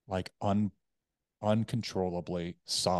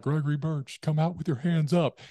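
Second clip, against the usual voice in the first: higher, louder imitated police command; police bullhorn; 8 semitones higher, 5 dB louder than usual. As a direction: call it out like police over a bullhorn. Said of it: "on, like, a bullhorn".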